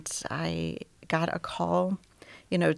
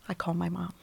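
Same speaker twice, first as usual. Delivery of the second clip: normal talking voice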